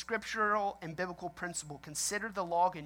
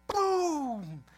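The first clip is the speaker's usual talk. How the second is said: gun going off; 8 semitones higher, 3 dB louder than usual. Retold or imitated. Retelling accidental gun discharge.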